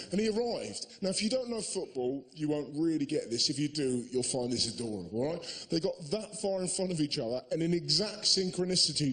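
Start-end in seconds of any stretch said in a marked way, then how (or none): none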